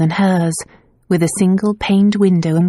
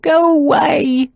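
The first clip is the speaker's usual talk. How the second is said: desperate plea; 10 semitones higher, slower than usual. A narrator's reading. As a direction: push her away, pleading. Repeated, more desperate.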